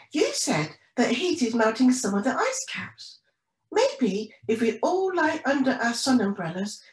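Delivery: high-pitched storybook penguin voice; eager young penguin. Acted. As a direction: explain your idea to Mum, pitched high. Penny explains her idea to Mum.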